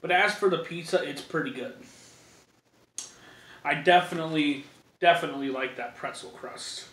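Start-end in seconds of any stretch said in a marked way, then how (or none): none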